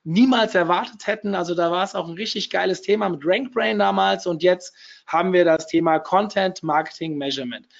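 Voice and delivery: ordinary delivery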